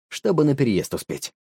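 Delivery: ordinary delivery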